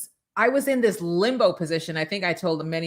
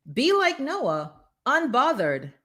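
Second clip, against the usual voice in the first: higher repeat; 7 semitones higher than usual. Shown as a demonstration. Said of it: "repeated slogan".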